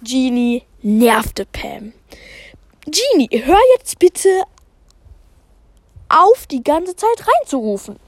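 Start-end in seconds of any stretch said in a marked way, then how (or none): 2.9-8.0 higher, mildly stern teacher; scolding teacher. Acted. Teacher Pam scolds Genie for interrupting, higher. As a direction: tell Genie off for shouting out.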